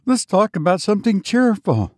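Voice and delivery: put-on high, quick old servant; old man's cry. A narrator's reading. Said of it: "cried the old man".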